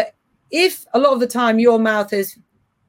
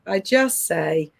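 ordinary delivery

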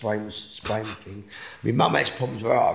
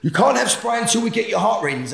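paramedic refusing; 12 semitones higher, 6 dB louder than usual. Retold or imitated. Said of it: "the ambulance are going".

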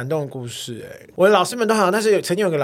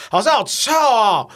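high, loud, slow whine; mock classmate call-out; 6 semitones higher, 4 dB louder, slower than usual. Classmate complains to the teacher, loud whine. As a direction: drag out the complaint.

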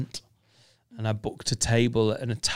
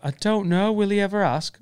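lightly voiced fretful girlfriend, higher; imitating a girl fretting over a proposal, higher; mock girl fretting; 7 semitones higher than usual. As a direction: pitch it up like a girl wondering if he'll ever ask. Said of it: "And the girl's like".

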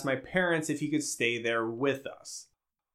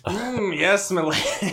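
creepy admirer; 6 semitones higher, slower than usual. Retold or imitated. Imitating Ed sniffing her toes.

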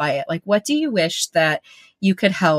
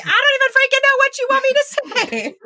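much higher, fast exasperated whine; frustrated kid; 3 dB louder, faster than usual. Retold or imitated. Child answering 'use your words', fast and exasperated. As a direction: blurt it out, fed up.

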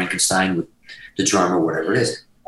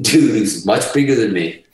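usual speaking voice

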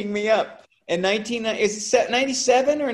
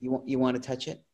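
conspiratorial hush; 5 semitones lower, 7 dB quieter, faster than usual. Retold or imitated. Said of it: "looks around and goes".

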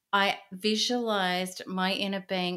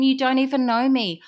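retold yell; 4 semitones higher, 7 dB louder than usual. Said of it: "all the times I'd screamed at him".

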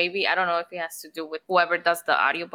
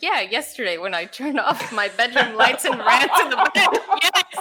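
laughing; 4 semitones higher, 4 dB louder than usual. Retold or imitated. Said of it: "I'm like".

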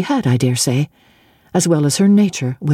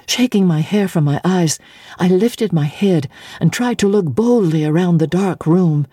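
plain reading voice

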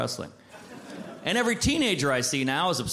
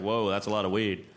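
put-on stoned teen voice; 10 semitones lower than usual. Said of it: "I was like".

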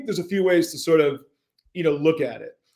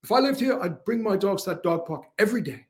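put-on a lightly British-accented friend, raised in pitch; 5 semitones higher than usual. Imitating Gary Ashton's British accent, lightly done. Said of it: "he said in that fine British accent".

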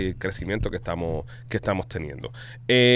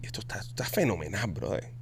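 hushed awe; 4 semitones lower, 11 dB quieter than usual. Acted. Telling co-hosts of the blind man at the play, hushed awe. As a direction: drop to a low, airy whisper.

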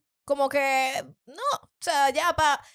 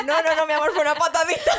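laughing reassurance; 5 semitones higher, 4 dB louder than usual. Retold or imitated. Retold reassuring husband, laughing.

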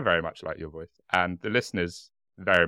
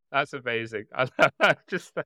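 normal talking voice

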